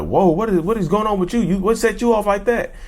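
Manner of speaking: mock alarm at a sudden outburst; shocked onlookers.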